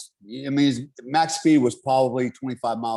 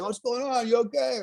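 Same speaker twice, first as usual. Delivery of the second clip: higher-pitched country-boy mimicry; imitated country boys; 9 semitones higher than usual. Country boys arriving at the crash, imitated in a high voice. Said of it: "all these freaking country boys show up".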